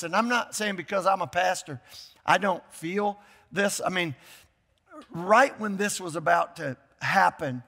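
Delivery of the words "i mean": ordinary delivery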